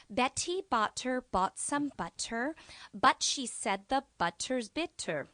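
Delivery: slow, crisp articulation; over-enunciate every consonant for the class; tongue twister demo. Shown as a demonstration. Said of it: "repeat after me".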